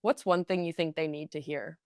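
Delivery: normal talking voice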